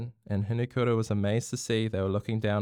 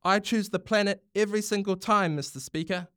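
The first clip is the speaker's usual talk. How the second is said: higher, rousing parliamentary address; passionate speech; 8 semitones higher than usual. Acted. Prime Minister addressing Parliament, a passionate speech. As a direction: argue for the planet with conviction. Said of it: "The Prime Minister's passion was clear".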